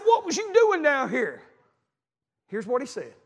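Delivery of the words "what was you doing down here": high, slow, drawn-out demand; exasperated questioning. Retold or imitated. Father confronting his young son off the trail, exasperated. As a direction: stretch the question out high, ending in a fall.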